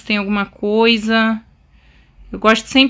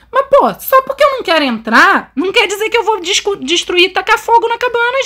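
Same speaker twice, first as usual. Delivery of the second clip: indignant protest; 8 semitones higher than usual. Real, not acted. Protesting the game's order.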